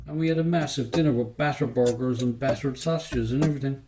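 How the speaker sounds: ordinary delivery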